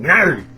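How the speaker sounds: loud imitated snarl; a sudden gnarr, as a hamster lunging to bite; biting hamster snarl. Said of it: "he whipped around and went".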